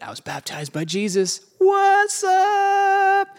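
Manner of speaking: mock the old-timers showing off; bragging older dudes. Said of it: "the older dudes are like".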